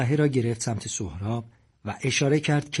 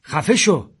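loud, higher barked order; shouting; 6 semitones higher, 8 dB louder, slower than usual. A narrator's reading. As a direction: shout him down. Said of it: "ساسان داد زد".

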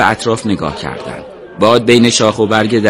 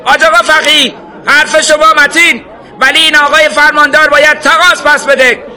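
high, loud yell from the crowd; shouted appeal; 4 dB louder than usual. A narrator's reading. Man in the crowd asking sheikh for retribution, shouting. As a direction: shout up to the cleric insistently. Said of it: "یکی فریاد زد".

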